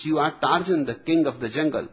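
ordinary delivery

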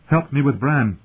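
pressing, alarmed plea; urgent. A narrator's reading. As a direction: demand help at once. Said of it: "she said urgently".

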